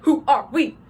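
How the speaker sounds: WHO ARE WE shouted as three separate punched words; emphatic statement. Shown as a demonstration. Drum major calls to the band, emphatic shouted statement. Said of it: "more of a statement".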